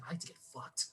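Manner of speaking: hushed near-whisper quote. Retold or imitated. Retelling the girl's confession in a hushed whisper. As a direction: whisper it like a secret. Retold girl's intimate line.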